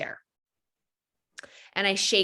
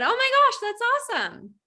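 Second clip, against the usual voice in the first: much higher, louder forced delight; gushing; 14 semitones higher, 3 dB louder than usual. Retold or imitated. Speaker answering the stranger mom, gushing and high. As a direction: gush with over-the-top enthusiasm.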